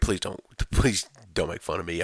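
ordinary talk

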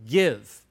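"Give" dropped low and firm as a cue to repeat; prompting the word. Shown as a demonstration. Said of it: "You can say it".

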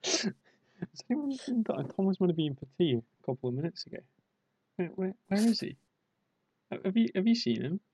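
put-on fretting parent looking for a lost child; worried parent. Parent searching for Tom.

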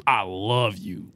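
slow, low, earnest declaration; really meaning it. Retold or imitated. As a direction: say it low and slow, like you truly mean it. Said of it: "they really said it like".

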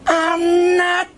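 high slow furious yell; shouting. Retold or imitated. Storyteller reenacts shouting at the woman, a high furious yell. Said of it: "I was shouting".

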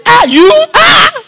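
high, loud shocked shout; shocked God. Retold or imitated. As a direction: cry out in mock disbelief. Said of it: "God will be shocked".

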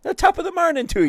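high, bouncy stage Irish; jokey fake Irish lilt; Irish accent. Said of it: "learning how to do an Irish accent".

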